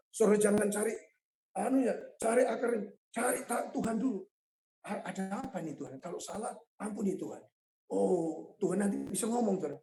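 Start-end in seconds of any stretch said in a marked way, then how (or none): 4.9-7.4 very soft, humble plea to God; hushed prayer. Acted. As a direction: ask God quietly, almost under your breath. (doa lirih memohon ampun)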